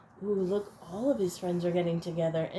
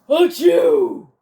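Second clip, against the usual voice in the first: huge, much louder, higher achoo; big loud sneeze; 8 semitones higher, 13 dB louder than usual. Acted. Bear sneezes from a pepper flake, loud high achoo. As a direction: let the sneeze explode. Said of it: "He blows and he sneezes".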